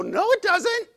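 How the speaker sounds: "No, it doesn't," jumps up high and loud; shocked objection. Retold or imitated. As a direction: blurt out a protest in disbelief. Imagined objection to Peter's claim.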